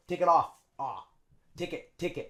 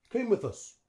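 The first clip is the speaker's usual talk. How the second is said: usual speaking voice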